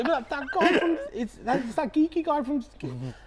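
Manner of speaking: high-pitched, laughing insistence; animated insistence. Real, not acted. Host assures the doubting guest they will, high and excited. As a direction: pitch it right up, insisting through amusement.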